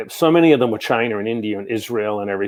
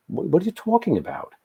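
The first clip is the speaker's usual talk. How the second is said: high, bewildered what-are-you-on-about; baffled cousin; 8 semitones higher, 5 dB quieter than usual. Retold or imitated. Cousin reacting to his flats-and-pounds talk, baffled and high. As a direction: sound lost and thrown by what he just said.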